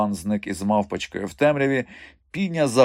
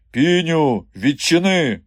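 loud, raised call through a door; shout; 7 semitones higher, 6 dB louder, slower than usual. A narrator's reading. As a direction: shout a firm order to open up. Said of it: "крикнув Сила". (гучний владний крик)

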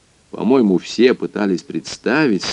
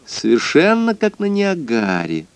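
high, ringing little lady's voice; tinkling crystal voice; 9 semitones higher than usual. Acted. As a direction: chime it like a crystal bell. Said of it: "прозвенел хрустальный голосок". (хрустальный звенящий голосок)

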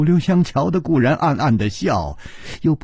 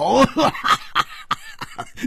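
big hearty laugh; 12 semitones higher than usual. A narrator's reading. Hu Tiehua bursts into a laugh at his friend. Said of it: "胡铁花大笑".

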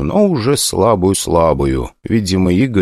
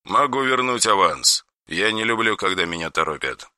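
softer, icy, emotionless; ice-cold tone; 8 dB quieter than usual. Acted. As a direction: quietly refuse to be rushed. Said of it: "совсем ледяным тоном".

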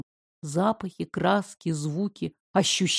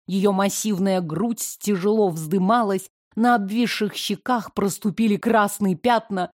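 ordinary delivery

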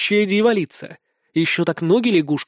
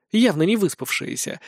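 plain reading voice

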